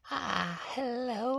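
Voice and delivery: high, soft crone cry; a creepy, keening hello; creepy hag. Shown as a demonstration. Hag greeting the players in a high, soft creepy cry. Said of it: "mix that creepy sound in with that".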